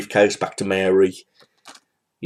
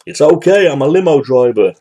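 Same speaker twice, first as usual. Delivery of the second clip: Lloyd's airport line, raised higher and louder; hammed-up Lloyd impression, higher and louder; Lloyd's goofy line; 5 semitones higher, 6 dB louder than usual. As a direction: blurt it out like Lloyd.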